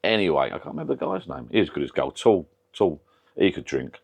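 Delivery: normal talking voice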